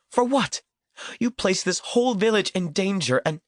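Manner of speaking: a woman's anger rising to a near scream at her captor; rising furious accusation. A narrator's reading. Maria berating Ernesto, voice rising.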